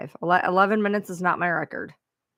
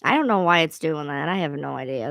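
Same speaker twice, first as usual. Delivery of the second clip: ordinary delivery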